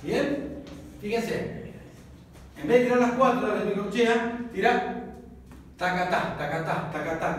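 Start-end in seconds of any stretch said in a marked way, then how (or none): 5.8-7.4 tacatá tacatá tacatá, beats tapped out like hooves; spoken triplet rhythm; rhythmic syllables. Shown as a demonstration. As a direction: chant tacatá in triplets like a galloping horse. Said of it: "como si fuera un caballo".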